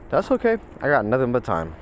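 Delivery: high, breezy "that's okay"; breezy unbothered. Retold or imitated. Customer brushing off a two and a half hour wait, breezy and high.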